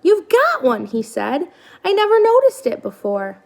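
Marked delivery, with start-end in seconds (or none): 0.0-0.9 put-on high, childlike little bird voice; gosling voice. 1.8-3.4 put-on high, childlike little bird voice; gosling voice.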